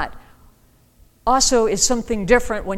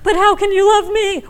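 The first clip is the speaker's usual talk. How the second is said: mock plea; 12 semitones higher, 7 dB louder than usual. Mock pleading question in sermon.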